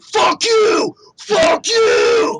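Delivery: hammed-up, high-pitched furious roar; Todd Howard going Hulk. Game developer raging at podcasters who criticised him, hammed-up furious shouting. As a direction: bellow it in a huge mock rage. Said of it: "he's just going total Hulk".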